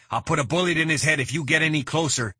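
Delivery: fast, high, loud threat; yelled threat. A narrator's reading. Blondie threatens to shoot the hostage, fast high yell. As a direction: rattle the threat off at a shout. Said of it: "he yelled".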